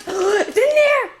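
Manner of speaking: high, slow thrilled call; high excited dog-game voice; excited high cry. Real, not acted. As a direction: gasp then draw it out high.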